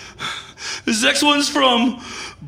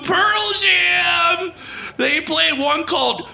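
DJ voice breaking into laughing sobs, higher; on-air breakdown; 4 semitones higher, slower than usual. Acted. Dumped DJ on the air to Chicago, voice cracking. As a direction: keep the radio patter while falling apart.

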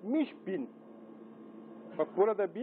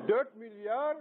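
ordinary talk

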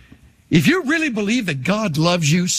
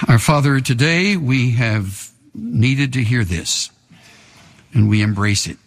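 low, hushed pastoral prayer; 8 semitones lower than usual. Real, not acted. Pastor praying over the congregation, reverent.

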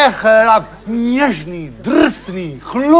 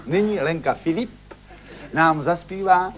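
announcer intro; 3 semitones lower, 9 dB quieter than usual. Acted.

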